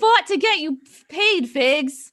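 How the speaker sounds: heated, indignant demand; real angry challenge. Real, not acted. Angry quarrel over who got pay.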